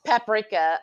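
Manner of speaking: PAPRIKA stressed on the first syllable; English pronunciation. Shown as a demonstration. Echoing another English word, PAPRIKA as "PAP-rik-uh". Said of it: "The English pronunciation".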